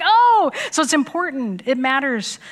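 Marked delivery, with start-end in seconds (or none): 0.0-0.5 higher, louder startled oh; a little gasp of surprise; stunned oh. Retold or imitated. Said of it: "I was like".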